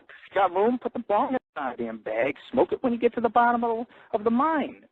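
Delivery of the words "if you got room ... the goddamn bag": ordinary talk, nothing marked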